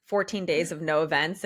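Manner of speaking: ordinary talk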